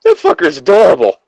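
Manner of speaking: high, loud delighted gush; cute gushing. Real, not acted. Friend gushing over a cute game character, delighted. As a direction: squeal over how adorable he is.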